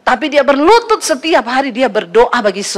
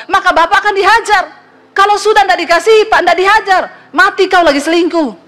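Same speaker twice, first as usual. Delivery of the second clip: higher, preachy comeback quoting scripture; fiery retort; 7 semitones higher than usual. Retold or imitated. Pastor fires scripture back at the angry husband, higher and heated. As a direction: lecture the cheater fast and heated.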